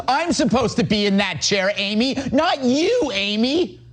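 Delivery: put-on high-pitched, petulant mean-girl voice; high school mean girl. Mean girl to a rival also named Amy, jealous, high mock whine. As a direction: whine it high like a jealous teen queen bee. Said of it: "She's that high school mean girl".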